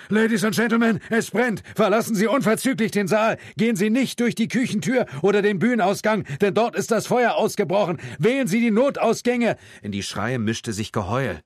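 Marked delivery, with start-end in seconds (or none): 0.1-9.6 loud, high-pitched emergency announcement; urgent public-address yell, sustained; loudspeaker announcement. A narrator's reading. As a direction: shout evacuation orders to a packed hall. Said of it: "Eine Durchsage über Lautsprecher".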